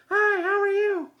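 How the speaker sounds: high falsetto woman's voice; Sheila's voice. Pretend. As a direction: squeak it out as the absent camerawoman. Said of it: "I'm not fooling anybody".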